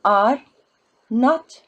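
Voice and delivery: are and not punched loud and separate; teacher stressing are not. Shown as a demonstration. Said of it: "remember fatha with ya".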